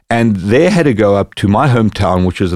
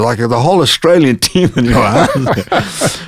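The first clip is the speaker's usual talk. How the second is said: laughing; 4 semitones higher than usual. Real, not acted. Laughing while retelling party.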